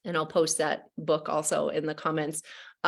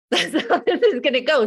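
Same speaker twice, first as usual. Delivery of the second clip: laughing; 9 semitones higher, 7 dB louder than usual. Real, not acted. Laughs at own threat joke.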